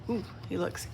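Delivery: breathless whispered ooh; whispered gasp. Real, not acted. Reader reacting to the picture, whispered.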